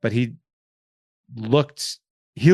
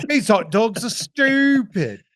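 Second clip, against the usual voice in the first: high, slow, loud London take; hammed-up British grumble; mock British accent; 10 semitones higher, 5 dB louder, much slower than usual. Mocking hot dogs in a fake London accent. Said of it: "Sorry, the, you".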